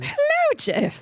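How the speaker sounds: high squeaky dummy voice; squeaky ventriloquist dummy. Pretend. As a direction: squeak it out like a puppet. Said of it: "say hello to R L Stein".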